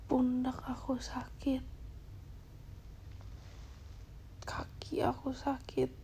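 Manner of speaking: tired whispered complaint. Real, not acted. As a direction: whisper the aches out softly. (keluhan lirih berbisik)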